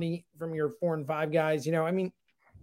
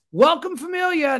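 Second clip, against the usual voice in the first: mock announcer's welcome, pitched up and loud; sarcastic welcome; 12 semitones higher, 8 dB louder than usual. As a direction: present him like a dreaded guest, mock-cheerful. Sarcastic welcome to the new reliever.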